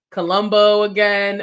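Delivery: normal talking voice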